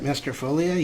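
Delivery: ordinary delivery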